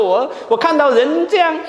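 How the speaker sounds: lightly voiced aggrieved sister, pitched high; voicing an upset sister airing complaints to the pastor, high and fretful; complaining church sister. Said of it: "很自然的一个想法".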